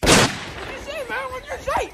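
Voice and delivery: high, loud alarmed calls. Acted. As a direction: call out in alarm. Alarmed questions.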